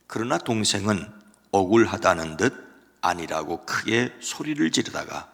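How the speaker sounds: normal talking voice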